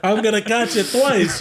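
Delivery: high-pitched comic despair; mock dread. Son dreading dad's reaction to the crash, panicked despair. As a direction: wail lightly about the trouble ahead. Dreads a beating from his dad.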